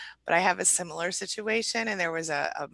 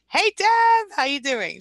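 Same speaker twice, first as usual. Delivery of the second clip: excited greeting; 8 semitones higher, 6 dB louder, slower than usual. Real, not acted. Host greets viewer Deb excitedly.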